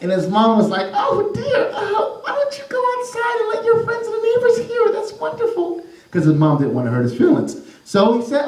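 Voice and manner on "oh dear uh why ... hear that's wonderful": high laughing mother voice; high tittering mom praising; polite mom. Acted. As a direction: hide the wince with a laugh. Mom politely praises bad playing.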